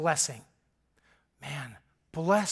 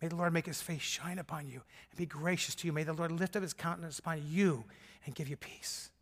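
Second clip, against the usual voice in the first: much softer, reverent blessing; hushed blessing; 3 semitones lower, 11 dB quieter than usual. Retold or imitated. Father softly blessing his grown son before he leaves, reverent. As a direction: lay a hand on him and bless him quietly. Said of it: "took his shoulder and said".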